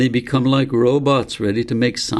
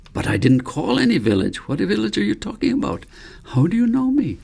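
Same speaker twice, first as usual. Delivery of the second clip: put-on a lightly raised, eager boy's voice; a hint of a puzzled youngster firing questions; young David voice; 11 semitones higher than usual. A narrator's reading. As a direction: let the curious boy pour out questions, pitched up. David questions the old man.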